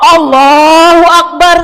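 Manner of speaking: slow, loud Allahu Akbar; loud takbir. Acted. Umar finally bows with a loud, slow takbir. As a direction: ring out the prayer call.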